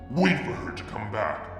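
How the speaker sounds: plain reading voice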